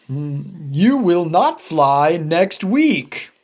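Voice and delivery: low, firm elephant voice; stern big friend laying down the law; Gerald voice. Acted. Gerald telling Piggy she cannot fly: low and firm.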